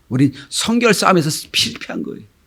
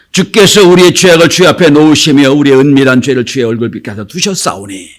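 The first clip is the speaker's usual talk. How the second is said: loud declaimed verse that drops at the end; scripture reading; 5 dB louder than usual. Acted. Preacher reads the verse to congregation, declaimed reading. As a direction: read it out formally to the congregation.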